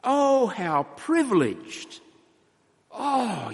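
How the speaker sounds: high, slow wondering exclamation. Shown as a demonstration. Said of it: "it implies an exclamation mark".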